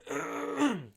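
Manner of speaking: a sharp, high clearing of a hoarse throat; real throat clear mid-recording. Real, not acted. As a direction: clear the throat hard before going on. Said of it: "Like, losing my voice".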